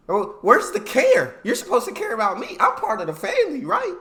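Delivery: loud, high-pitched indignant challenge; loud mock outrage, rising to a high questioning tag; indignant protest. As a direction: fire it at the boss, incredulous and pushy. Acted outburst at uncaring employer.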